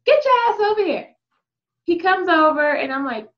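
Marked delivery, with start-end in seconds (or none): 0.0-1.2 high, rapid-fire order; commanding. Retold or imitated. Telling the husband to come over, quick sharp command. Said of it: "Literally, like, that's it".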